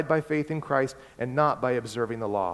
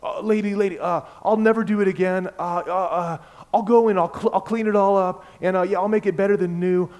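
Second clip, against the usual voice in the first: put-on higher, louder, fast frantic begging boy; panicky kid pleading; 6 semitones higher, 6 dB louder than usual. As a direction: babble out desperate promises to the angry neighbor. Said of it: "he starts making these empty promises".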